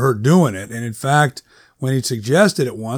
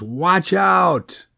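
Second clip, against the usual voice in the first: retold scream; 4 semitones higher than usual. A narrator's reading. Woman retelling her warning to a car, mild mock scream. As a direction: call it out high with held-back volume. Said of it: "I screamed at the top of my lungs".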